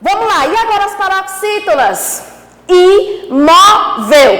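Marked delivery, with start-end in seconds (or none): 2.7-4.3 "Imóvel" drawn out in three loud beats, "i, mó, vel"; syllable by syllable. Shown as a demonstration. Teacher showing paroxítonas to pupils, "Imóvel" in slow, loud syllables. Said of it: "o mó como tônica".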